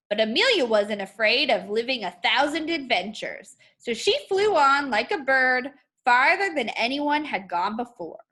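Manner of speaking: lively picture-book read-aloud with rising lines; reading to children. Acted. Teacher reading a picture book to her class, lively storytelling voice. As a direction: tell it like a bedtime story for young kids.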